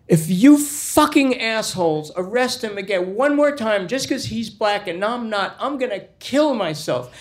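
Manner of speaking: high-pitched acted tirade; high, indignant child's rant; furious kid outburst. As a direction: rage at them in a high voice.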